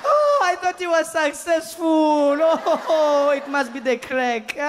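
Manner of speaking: high, slow fretting grandmother. Retold or imitated. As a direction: wail like a worried granny. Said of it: "she'd be like".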